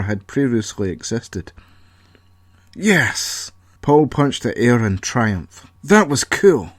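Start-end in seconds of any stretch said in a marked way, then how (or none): 2.7-3.5 high triumphant yes; triumphant cheer. Acted. Triumph after blast. 5.8-6.7 higher, louder delighted exclaim; thrilled by the blast; excited. Acted. Paul thrilled by explosion.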